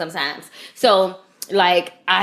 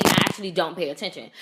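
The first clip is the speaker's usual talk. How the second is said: ordinary talk, nothing marked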